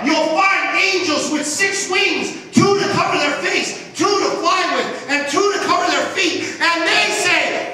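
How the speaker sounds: loud, high, ringing sermon voice; preaching at full force. Acted. Preacher lifting his voice to the church, loud and high.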